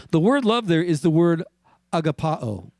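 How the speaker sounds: usual speaking voice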